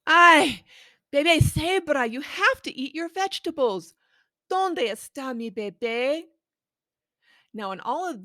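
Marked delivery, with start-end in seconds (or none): 0.0-6.2 higher, louder worried mama voice; storybook parent calling for her baby; fussy parent elephant. Acted. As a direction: fret like a busy parent looking for baby. Parent voice as before.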